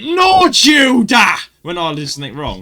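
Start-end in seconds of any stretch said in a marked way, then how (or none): 0.0-1.4 slow, high, loud yell of a name; teacher's shout. Retold or imitated. Teacher yelling at a pupil who did wrong, loud and drawn out. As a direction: bellow a pupil's name in dismay. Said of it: "He's the guy that went".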